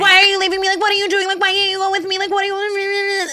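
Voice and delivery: very high, loud clingy-friend wail; mock whining. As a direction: whine nonstop like an abandoned drunk girl. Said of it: "that's literally how she sounds".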